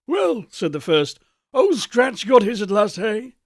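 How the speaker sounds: a wealthy man enjoying the gossip, a touch hearty; gossiping businessman. Acted.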